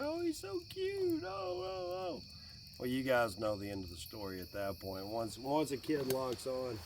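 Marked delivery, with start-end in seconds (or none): 0.0-2.2 high cooing over a cute dog; imitating daughter cooing. Daughter coos over the dog, high and soft. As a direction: coo like a smitten kid, much higher. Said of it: "She's like".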